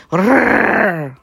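loud rolling growl; big dog growling. A narrator's reading. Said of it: "morogta a kutya".

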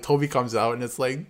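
ordinary talk

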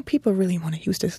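ordinary talk, nothing marked

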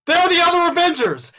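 high, loud excited yell in a packed theater. Retold or imitated. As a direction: shout it out to the whole crowd. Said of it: "I yell out".

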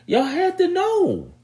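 Y'all had to know, voice jumping way up on know; mock high incredulous squeal; squeaked-up disbelief. Real, not acted.

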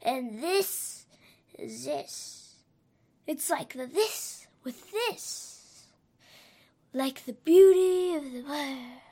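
dreamy rising lines, swelling loud at the end; wise poetic voice. Shown as a demonstration. A kid demoing how a wise speaker sounds, poetic and swelling. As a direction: savor each word like a sage admiring the world. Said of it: "With wisdom, it would be more like".

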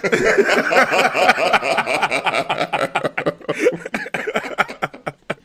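loud, high burst of laughter; helpless real laughter; long laugh. Real, not acted. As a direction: crack up at your own joke. Laughing at own impression.

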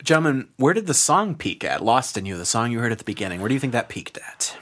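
usual speaking voice

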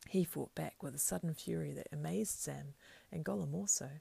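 ordinary delivery, nothing marked

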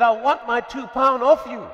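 a creditor shouting at his debtor; angry demand. Acted. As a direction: bark it at the man you're choking. Unforgiving servant choking his debtor.

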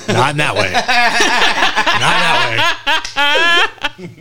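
high-pitched, helpless laughing denial. Real, not acted. Teller taking back an accidental innuendo, laughing. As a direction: laugh right through the words.

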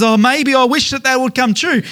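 mock wishful hope. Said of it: "the world's version of hope".

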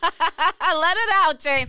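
slow, high mock coaching to exhale; slow teasing encouragement; coaxing. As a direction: egg her on like a breathing coach. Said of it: "coaxing her friend to exhale".

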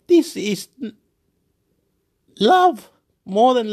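high, loud burst of joy; mock overjoyed. Brain overjoyed in the stadium, mock overjoyed cry.

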